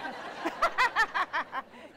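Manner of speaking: another real burst of laughter. Real, not acted. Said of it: "I laugh all day long".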